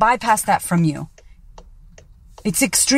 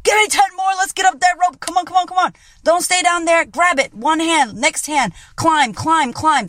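high-pitched, shouted coaching commands; urgent personal trainer urging climbers up a rope; boot camp drill sergeant; 7 semitones higher than usual. Acted. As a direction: bark orders like a drill sergeant. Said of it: "drill sergeant going".

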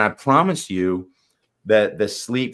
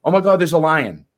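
loud, higher startled cry at a lion; mock alarm; 7 semitones higher, 7 dB louder than usual. Coach showing the mind's alarm, higher and louder. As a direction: act out the panic of spotting a lion. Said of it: "If I said".

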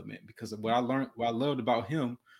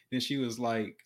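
usual speaking voice

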